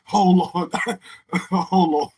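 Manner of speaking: real teary cracking up; laughing to tears. Real, not acted. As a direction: gasp for a pause between laughs. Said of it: "I got tears in my eyes".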